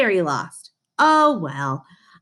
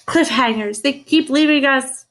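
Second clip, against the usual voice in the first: mock exasperated; 6 semitones higher, 5 dB louder than usual. Real, not acted. Reader's playful lament.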